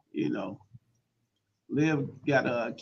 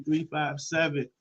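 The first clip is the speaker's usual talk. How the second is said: ordinary talk, nothing marked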